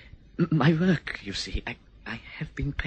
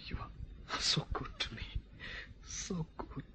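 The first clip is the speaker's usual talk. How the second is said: fading whisper; 3 semitones higher, 12 dB quieter than usual. Acted. Moved, kissing her hands.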